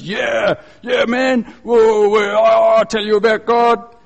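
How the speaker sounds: put-on loud, high drunken whooping; drunk heckler. Drunk jumping up in theology class, imitated high drawn-out shouting. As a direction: shout it out like a rowdy drunk, dragging the last words. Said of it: "He's drunk, and he keeps jumping up".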